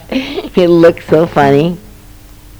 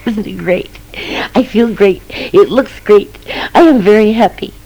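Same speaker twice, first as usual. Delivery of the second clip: higher, gleeful little mouse, louder at the end; grinning mouse voice; 7 semitones higher, 3 dB louder than usual. Acted. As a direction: squeak out the joy like a tiny happy mouse. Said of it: "and he grinned, and he said".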